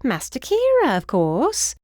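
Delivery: high, swooping British announcement; British housekeeper announcing. A narrator's reading. Housekeeper names who sent her, announcing in British voice. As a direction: announce it as self-evident, British voice. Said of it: "the woman announced".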